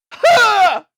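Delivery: loud, very high yelp of exertion; imitated jump yell. Imitating Nathan Drake making a long jump, high yell. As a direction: let out a sudden high shriek mid-leap. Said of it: "when he just goes".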